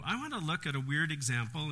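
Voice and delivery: usual speaking voice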